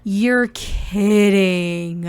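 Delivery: gasping friend who can't believe the news; shocked disbelief. Retold or imitated. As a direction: drag out the name in total shock. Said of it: "She is like".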